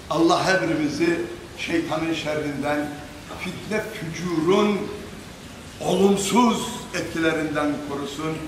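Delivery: prayer. Acted. Closing prayer for the audience.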